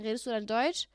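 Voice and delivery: ordinary delivery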